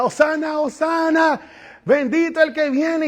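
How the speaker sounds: loud, high jubilant cry of the crowd; crowd shouting hosanna. Acted. Crowd shouting at triumphal entry.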